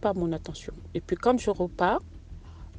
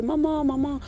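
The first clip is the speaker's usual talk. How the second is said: put-on higher, sing-song call of a little boy; child calling mama; 8 semitones higher than usual. Little son calling the nanny, higher childlike call. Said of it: "commence à l'appeler".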